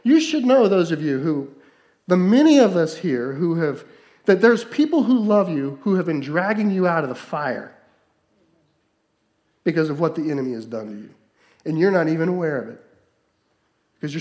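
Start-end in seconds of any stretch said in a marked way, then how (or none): 0.0-11.0 louder, urgent sermon delivery; fervent preaching. Acted. Pastor urging his congregation, fervent preaching. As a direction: drive it home to the congregation.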